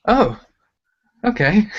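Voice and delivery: high, light oh of discovery; imitated realization. People hearing about the looping technique, imitated realization. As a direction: mimic people pleasantly taken aback.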